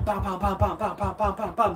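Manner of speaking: rapid mock punch noises, upbeat and laughing; punch sounds. Shown as a demonstration. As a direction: pam pam pam like quick jabs. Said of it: "Et tu vas faire ici". (bruitage de coups de poing en riant)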